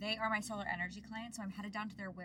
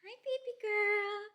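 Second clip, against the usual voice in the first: squeaky praising a dog; 13 semitones higher, 9 dB louder, slower than usual. Real, not acted. Greeting her dog on return.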